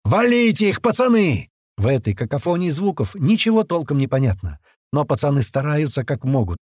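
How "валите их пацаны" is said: high, rousing battle cry; yelled order. A narrator's reading. Туман urging his men to kill, yelling. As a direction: holler to spur the lads on. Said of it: "орёт Туман на общей волне".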